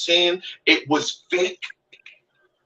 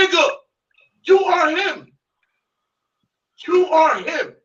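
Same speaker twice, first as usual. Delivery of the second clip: much higher, pointed accusation; high accusing shout; 10 semitones higher than usual. Real, not acted. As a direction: jab each word at him.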